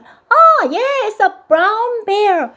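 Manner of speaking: very slow, high, stretched praise; slow praising expansion. Shown as a demonstration. Mom expanding the child's answer, high and slow. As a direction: model the full phrase gently. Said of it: "컬러를 넣어주는 거예요".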